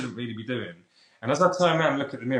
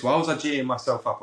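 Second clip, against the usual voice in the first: usual speaking voice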